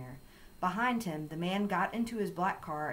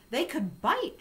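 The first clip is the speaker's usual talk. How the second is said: high, raised-voice warning; loudly; 9 semitones higher, 5 dB louder than usual. A narrator's reading. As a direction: warn loudly from the porch steps. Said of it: "she said loudly".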